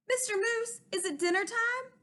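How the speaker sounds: high, eager little rabbit; small high rabbit asking about dinner. Acted. Rabbit's squeaky character voice.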